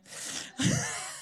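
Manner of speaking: light, high breathy laugh; brief unvoiced chuckle at an aside. Real, not acted. Preacher joking about sharing sweets, laughing.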